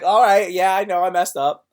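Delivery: loud, breezy "no big deal" admission; quoting his teenage self, breezy and loud; casual admission. Retold or imitated. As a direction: shrug it off out loud. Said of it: "I'd be like".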